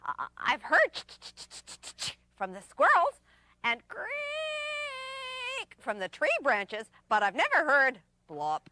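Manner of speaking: high, chattering little chipmunk. Acted. Chipmunk voice held.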